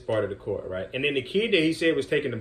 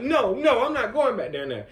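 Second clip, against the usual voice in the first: put-on higher, louder stubborn child refusal; sulky kid; 13 semitones higher, 5 dB louder than usual. Sulking boy refusing to return to the game, higher stubborn kid voice. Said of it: "He's like".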